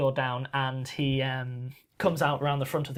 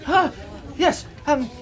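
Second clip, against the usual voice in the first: fussy tailor voice; 9 dB louder, slower than usual. Acted. Tailor flustered.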